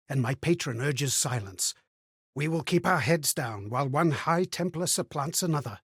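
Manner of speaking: usual speaking voice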